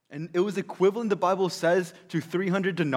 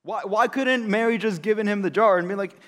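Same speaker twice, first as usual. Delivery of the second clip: higher and louder, indignant; angry Judas; 6 semitones higher, 8 dB louder than usual. Acted. Judas protesting the wasted perfume, angry. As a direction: scold the waste. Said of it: "He was angry".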